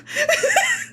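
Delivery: very high squeaky giggle; high mock giggle. Imitating Parasi laughing in chat, high giggle. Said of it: "That's how she does it".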